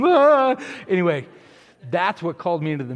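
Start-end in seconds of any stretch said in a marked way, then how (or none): 0.0-0.6 sudden high, loud yelled "Ah!"; imitated scream of a preacher. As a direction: let out a huge preaching cry.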